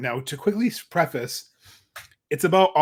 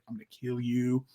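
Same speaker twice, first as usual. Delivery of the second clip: low, much softer menacing murmur; creepy threat; 4 semitones lower, 8 dB quieter than usual. Retold or imitated. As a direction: purr the threat quietly. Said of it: "Like the creepy".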